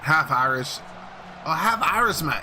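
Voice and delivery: put-on loud, failed stab at an Irish accent; badly faked Irish accent. Streamer failing at a half-Irish character, bad Irish accent. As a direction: have a clumsy go at Irish. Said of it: "I can't do an Irish accent".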